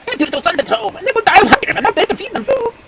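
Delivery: high-pitched imploring bird voice; pleading wild duck. Acted. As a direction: plead for your young as a little duck.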